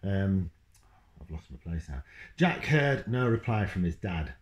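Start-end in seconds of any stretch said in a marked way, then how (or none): none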